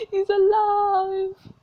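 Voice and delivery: loud, high, drawn-out cry; hammed-up, stretched-out proclamation; dramatic exclamation. Dying mother reveals the father lives, dramatic exclamation.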